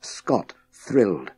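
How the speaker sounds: plain reading voice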